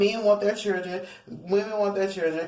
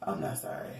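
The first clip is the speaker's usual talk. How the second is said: dropped low and soft, teasing; mock unapologetic low aside; cheeky; 9 dB quieter than usual. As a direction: take back the apology with a smirk. Joking retraction after rap.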